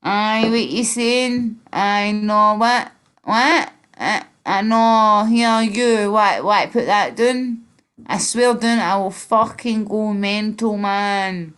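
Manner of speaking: slow, drawn-out, loud Glaswegian; mock-aggressive Glasgow accent, slow and forceful; thick Glasgow accent. Shown as a demonstration. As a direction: do a thick Glasgow hard man, stretching the words. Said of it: "Thick accent, thick accent, like Glasgow".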